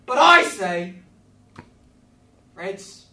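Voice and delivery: manic excitement. Real, not acted. Host to kids, manic laughing presentation. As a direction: sell it like a mad host.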